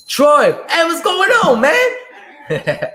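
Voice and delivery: high, loud joyful hello; thrilled to see a regular in the chat; excited greeting. Real, not acted. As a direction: shout a happy welcome to a buddy. Greets a viewer on stream.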